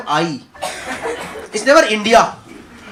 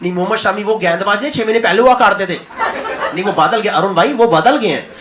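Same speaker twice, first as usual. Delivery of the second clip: quick mock Urdu Punjabi insistence; lightly done Punjabi commentator accent; Shoaib's accent; 3 semitones lower, faster than usual. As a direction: defend Shami like Shoaib Akhtar. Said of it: "Urdu Punjabi accent".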